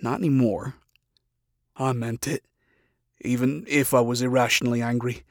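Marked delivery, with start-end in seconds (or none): none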